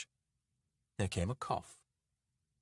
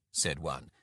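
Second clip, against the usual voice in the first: plain reading voice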